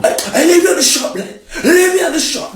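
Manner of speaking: very high, fast, laughing mimicry; mock her in a silly high squeak; squeaky mocking voice. Boyfriend mocking his girlfriend's words in a squeaky voice. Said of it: "you're like".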